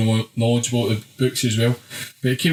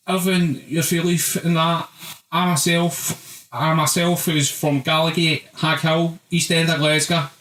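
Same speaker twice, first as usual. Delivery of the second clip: higher mock Glasgow hard man, slow start; mock East End fan voice, higher; mimicking the fan; 5 semitones higher, slower than usual. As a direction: lampoon the guy asking the question, pitched up. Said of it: "First boy, sitting on a".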